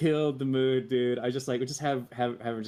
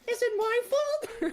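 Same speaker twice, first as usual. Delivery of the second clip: very high, slow, laughing squeak of the line; squeaky cartoon voice; slower than usual. Crew member mimicking the clip's squeaky high line. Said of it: "we'll be like".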